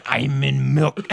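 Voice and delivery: loud, slow nonsense announcement; goofy prank voice. Retold or imitated. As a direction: draw it out loudly in a silly put-on voice. Silly prank reply over radio.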